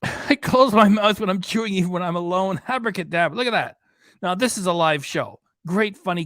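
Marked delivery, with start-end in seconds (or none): 0.0-3.8 laughing. Real, not acted. Laughing reading super chat.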